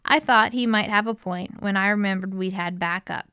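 ordinary delivery

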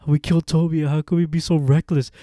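loud, high bickering goons; panicked arguing. Retold or imitated. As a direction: act out a frantic blame match. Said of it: "they start arguing back and forth".

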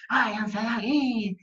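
playful imitation of a protest shout; mothers yelling. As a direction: imitate angry moms shouting them off. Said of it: "las mamás gritaban así como".

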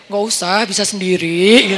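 drawn-out, put-on bravado; mock too-proud-to-need-help, stretching the end; feigned proud refusal. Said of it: "Saya pura-pura gengsi gitu dong ya".

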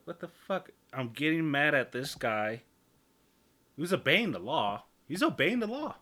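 high, loud mock outrage; retold exasperation. Podcaster retelling getting mad at a law-abiding driver, mock anger. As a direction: rant in hammed-up disbelief at the other driver.

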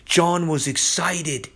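ordinary talk

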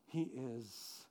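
hushed, reverent whisper; awed whisper. Acted. Confessing to the centurion, in an awed whisper. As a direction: breathe it out in awe.

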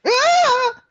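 sudden high yelp; a sharp, high cry of surprise; startled yelp. Real, not acted. Sudden cry mid-conversation.

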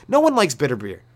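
ordinary talk, nothing marked

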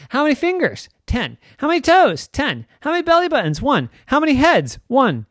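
put-on high rapid-fire kid questions; playful quizzing of her brother; child quiz. Quick counting quiz, children.

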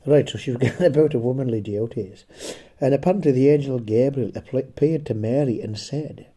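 usual speaking voice

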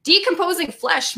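high, declarative announcement; announcing. A narrator's reading. Missus Penny answers about the smell, announcing. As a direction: proclaim it in a high voice. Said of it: "Missus Penny announced".

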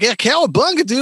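a touch of excitable cartoon hero; lightly voiced cartoon Turtle. Comic imitating the other Turtles, lightly hammed-up cartoon voice. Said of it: "The rest of them, it was like".

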